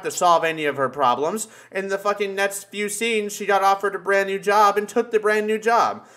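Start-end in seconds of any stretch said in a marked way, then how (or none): none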